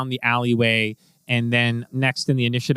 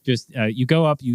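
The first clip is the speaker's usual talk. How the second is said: ordinary talk, nothing marked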